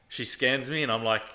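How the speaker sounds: ordinary talk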